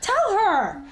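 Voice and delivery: high-pitched, distressed plea; urgent cry at the screen. Real, not acted. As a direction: plead urgently with the character. Urging the character on screen.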